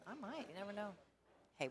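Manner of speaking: ordinary talk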